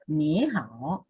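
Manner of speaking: "ni" rising like a question in "ni hao"; questioning first syllable. Shown as a demonstration. Teacher models the greeting, questioning first syllable. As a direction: make "ni" a question, then drop to "hao". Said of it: "pregunto en la primera sílaba".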